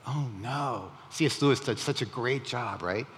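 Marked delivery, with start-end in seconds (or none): 0.1-0.9 despair. Retold or imitated. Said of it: "I remember that despair".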